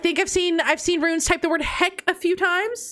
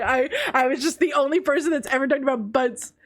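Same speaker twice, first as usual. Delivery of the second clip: ordinary talk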